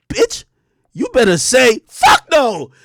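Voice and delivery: high, loud yell of a plea; mock shout. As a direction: shout it with your eyes at her. Silently pleading with girlfriend.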